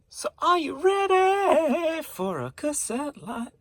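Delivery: very high, slow, loud hype call; hype announcer. Acted. Seller hyping viewers for the cassette lot. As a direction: stretch it out like a game show host.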